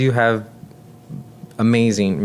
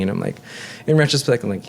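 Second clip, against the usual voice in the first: ordinary talk, nothing marked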